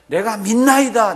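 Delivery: loud, high, slow retold declaration; crying out. Father declaring his belief to Jesus, loud outcry. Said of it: "그렇게 얘기를 했습니다".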